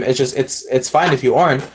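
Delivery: ordinary talk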